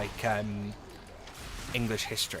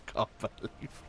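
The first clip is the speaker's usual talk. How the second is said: normal talking voice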